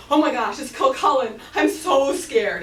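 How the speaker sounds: loud, high panicked cry; terrified. Acted. Finn hearing Cuchulainn approach, loud panicked cry. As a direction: panic as the giant nears. Finn hears giant coming.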